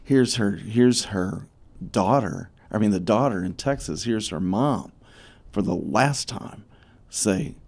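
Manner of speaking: ordinary delivery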